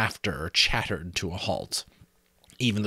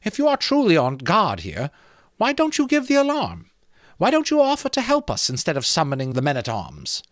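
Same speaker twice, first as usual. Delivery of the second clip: higher, a touch of timid pleading; Molly questioning the skull uneasily; timid young woman; 10 semitones higher, 5 dB louder than usual. A narrator's reading. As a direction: ask carefully, a little afraid. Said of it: "A little timidly, Molly Grew asked".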